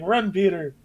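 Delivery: high-pitched mock cry of warning; mock movie call. Acted film villain's call.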